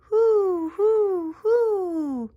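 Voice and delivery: high, loud, drawn-out hoots; long falling owl calls. Pretend. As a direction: hoot like a calling owl. Voicing the toy owl.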